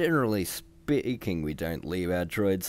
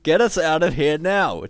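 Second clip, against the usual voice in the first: shouting; 8 semitones higher, 8 dB louder than usual. A narrator's reading. Hans keeps shouting.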